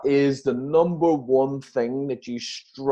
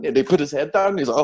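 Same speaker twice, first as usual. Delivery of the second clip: usual speaking voice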